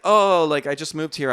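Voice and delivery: high, loud "oh" easing down; retelling a taken-aback, friendly explanation; apologetic newcomer. Retold or imitated. Retells own startled friendly reply.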